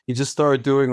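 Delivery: usual speaking voice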